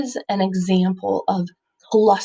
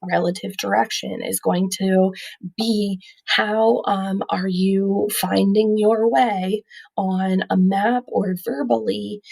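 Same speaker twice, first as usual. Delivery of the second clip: normal talking voice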